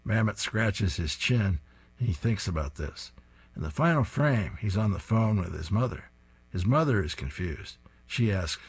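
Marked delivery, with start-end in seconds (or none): none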